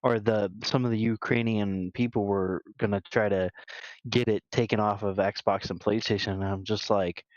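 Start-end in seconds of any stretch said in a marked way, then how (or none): none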